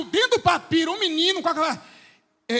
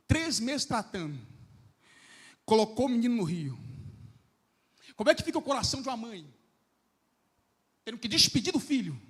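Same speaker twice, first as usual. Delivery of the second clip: hushed sermon narration; 4 semitones lower, 12 dB quieter than usual. Acted. Preacher softly telling the mother's farewell, hushed. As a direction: draw the congregation in quietly.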